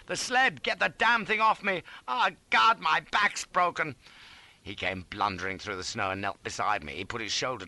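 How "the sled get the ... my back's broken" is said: high, loud pained wail; frantic high-pitched pleading; panicked cry. A narrator's reading. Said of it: "I cried".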